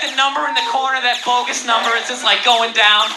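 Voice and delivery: ordinary talk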